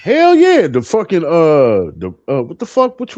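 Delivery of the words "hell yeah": excited yes. Real, not acted. Excited answer about drive-in.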